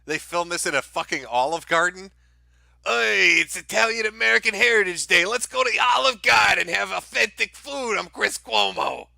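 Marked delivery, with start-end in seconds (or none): 2.9-9.1 higher, louder, shouting mock Italian tough guy; mocking Chris Cuomo with a big loud put-on Italian voice. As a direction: belt it out like a cartoon Italian mobster. Host mocks Cuomo as Italian stereotype.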